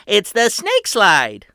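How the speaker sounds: put-on high, loud tour-guide kid; proud boy host. A narrator's reading. Patrick welcoming his family underground, high and proud. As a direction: announce it like a grand showman. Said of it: "Or I should say".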